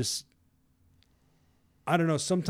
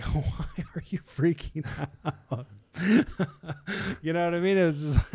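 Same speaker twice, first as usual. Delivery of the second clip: put-on amused parent chuckling at panicking kids; laughing gently at the fuss; 6 semitones higher than usual. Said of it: "I'm, like, laughing".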